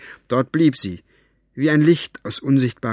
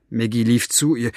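ordinary delivery

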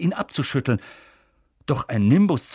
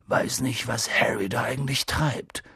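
Hagrid murmuring. Acted. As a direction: mutter it into the binoculars. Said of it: "murmelte Hagrid".

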